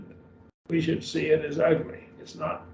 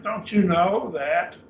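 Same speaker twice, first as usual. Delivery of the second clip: slow, higher-pitched challenge on "Don't you know that?"; rhetorical question; 6 semitones higher, 4 dB louder, slower than usual. Shown as a demonstration. Teacher voices the apostle's retort to his readers, rhetorical question. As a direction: stretch out "Don't you know that?" with raised pitch, expecting no answer. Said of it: "It's a rhetorical question".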